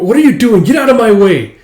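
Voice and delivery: loud, high "get out of my way"; girl yelling at Tao to let her go; angry shout. Acted. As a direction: yell at him to move. She shoves against Tao's hug.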